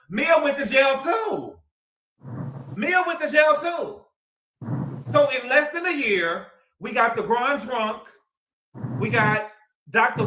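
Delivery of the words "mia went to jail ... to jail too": slow emphatic shout. Real, not acted. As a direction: hammer home that Mia went too. Insisting loudly.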